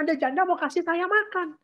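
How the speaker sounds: lightly mocking Elijah; mock naive hope. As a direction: parody the trusting prophet. Mimicking Elijah's hopeful expectation.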